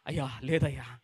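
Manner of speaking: low, soft reply; humble father. Acted. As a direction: answer meekly. Father answers Jesus humbly.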